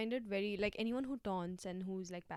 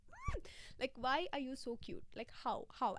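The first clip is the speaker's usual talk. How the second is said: much higher cooing, gushing; cooing over something cute; 4 semitones higher than usual. Acted. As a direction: melt over how cute it is.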